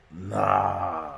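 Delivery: long low drawn-out nah; disbelieving onlookers. Retold or imitated.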